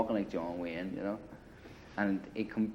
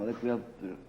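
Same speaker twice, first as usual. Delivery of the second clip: ordinary talk, nothing marked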